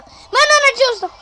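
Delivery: high, loud protest; outraged complaint. Real, not acted. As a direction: cry out at the unfairness. Complaining at game.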